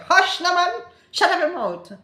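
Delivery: high, loud Jamaican scold; silencing scold. Shown as a demonstration. Scolding someone to shut up, loud and high. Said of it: "when you're trying to silence someone, you say".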